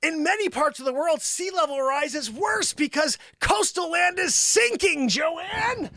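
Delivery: high, insistent yell; screaming at her. Acted. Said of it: "doesn't learn unless you scream at her".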